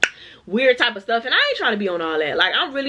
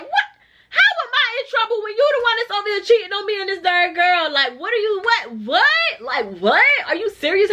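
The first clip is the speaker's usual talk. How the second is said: disbelief; 6 semitones higher than usual. Retold or imitated. Incredulous reaction to cheater's call.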